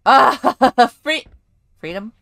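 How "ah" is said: loud, sudden yelp; startled yell. Real, not acted. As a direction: yell out as if jumped. Startled in horror game.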